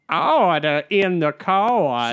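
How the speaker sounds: hammed-up judge calling the room to order; slow, stretched mock-judicial proclamation; Sandra Day O'Connor impression. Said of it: "I'd love for you to do Sandra Day O'Connor".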